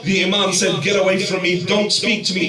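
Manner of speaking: fiery preaching. Acted. Preacher warning the youth about swearing, fiery sermon. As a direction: preach it out at full force to the crowd.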